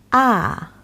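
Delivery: "Ah" dropped sharply from high; falling tone. Shown as a demonstration. Teacher showing students the falling fourth tone. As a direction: snap "Ah" down from the top. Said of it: "fourth one is a falling one".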